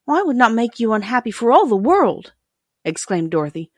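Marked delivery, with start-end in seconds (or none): 0.1-2.3 lightly voiced girl, higher pitch; a hint of a girl exclaiming kindly; earnest young girl. A narrator's reading. Dorothy exclaims reassurance to princess.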